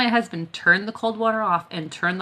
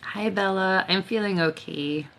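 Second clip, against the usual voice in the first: normal talking voice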